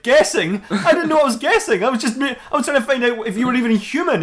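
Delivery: high, laughing mock disbelief; incredulous. [name] objects to the guessing remark, incredulous. [name] baffled by the Doctor's answer.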